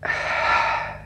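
long, defeated sigh; resigned sigh. Acted. Gentleman giving in to arrest, resigned sigh. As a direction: let out a sigh of surrender.

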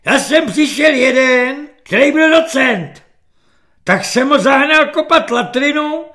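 high, loud barking drill corporal; screeching corporal. Acted. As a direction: yell it at the recruit like a shrill petty corporal. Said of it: "ječivým hlasem svobodníka".

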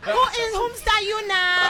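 high, indignant mock Nigerian accent. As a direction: demand it in a hammed-up Nigerian accent. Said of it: "speak to Nick in a Nigerian accent".

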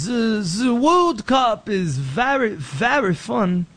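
slow, hammed-up French accent; drawn-out mock French English. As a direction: put on a halting French accent. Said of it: "Like France".

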